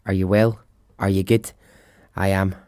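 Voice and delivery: normal talking voice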